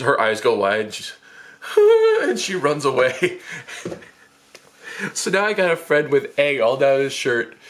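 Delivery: ordinary delivery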